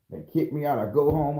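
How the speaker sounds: normal talking voice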